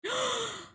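sharp high gasp of delight; thrilled little gasp. Real, not acted. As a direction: gasp at the surprise. Autumn arrives in game.

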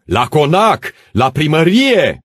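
high, loud rallying cry; crowd shouting. Acted. Armed peasants rallying to the manor, shouting. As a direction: shout it out, stretching the last word.

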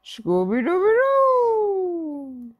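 long loud slow dog howl; Scooby-Doo catchphrase. A narrator's reading. Scooby celebrating the food with his catchphrase. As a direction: howl it out with joy.

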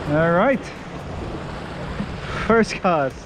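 ordinary delivery

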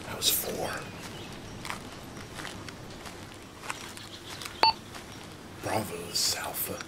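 hushed whisper on the radio. Real, not acted. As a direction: whisper so the enemy can't hear. Radio whisper near enemy.

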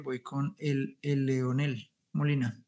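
ordinary talk

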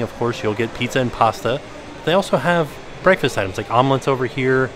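normal talking voice

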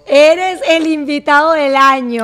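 loud, slow proclamation; hyped praise. Real, not acted. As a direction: proclaim it like an award, loud and stretched. Host hypes the guest.

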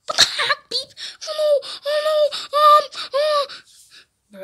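high, squeaky panic; play-acted panic. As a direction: fret in a high pretend fright. Panic as zombie fails to recognise.

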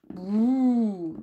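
rolling purr trill; cat purr. A narrator's reading. Cat answering from the dark.

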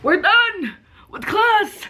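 high, loud, drawn-out celebration; excited shout. Real, not acted. Vlogger telling viewers class is over, excited shout. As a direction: belt it out slowly, high and elated.

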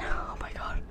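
unvoiced scared whisper. Real, not acted. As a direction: breathe it in shock. Scared aside.

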